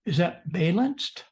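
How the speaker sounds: "balanced" said BAY-lanced, questioning; "balanced" as BAY-lanced, rising; wrong pronunciation. Shown as a demonstration. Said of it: "No, it's balanced".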